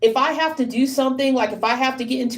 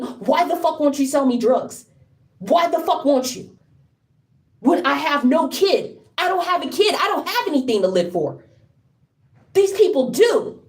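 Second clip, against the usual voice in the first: higher-pitched, anguished outburst; angry desperation; 4 semitones higher than usual. Real, not acted. Speaker demands to know why nobody helps, anguished anger. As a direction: plead in real anger, pitch jumping up.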